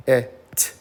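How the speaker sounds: K-E-T as separate sounds: k, eh, t; phonics-style split of K-E-T into k, eh, t; sounding out. Shown as a demonstration. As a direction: sound out K-E-T one sound at a time. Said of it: "let's break it up".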